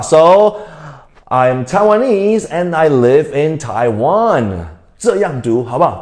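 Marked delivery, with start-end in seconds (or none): 0.0-4.7 very slow, stress on nese of Taiwanese; slow teacher model, last syllables stressed; model sentence. Shown as a demonstration. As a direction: say it slowly for the class to repeat. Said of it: "就跟我说一遍吧".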